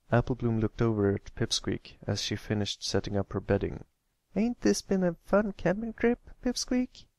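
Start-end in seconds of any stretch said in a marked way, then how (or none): 4.4-7.1 much higher, cheery filly; cheery smile. Acted. Applebloom asks Pipsqueak about the trip, cheery. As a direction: ask with a big smile. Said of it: "she asked with a cheery smile".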